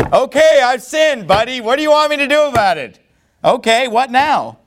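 high, exasperated mock confession; fed-up sarcastic outburst. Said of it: "he's using it as a sarcastic".

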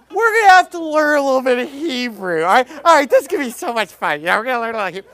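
mock him as if teaching toddlers; Jim Shetler. Preacher mocking a colleague's Hebrew lesson, exaggerated talking-to-toddlers voice. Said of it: "This is exactly how the guy talks".

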